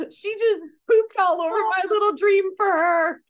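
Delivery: high, loud, voice cracking with laughter; helpless laughter over every word; laughing to tears. Real, not acted. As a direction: wail it out through real laughter. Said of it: "I'm, like, crying. I'm laughing so hard".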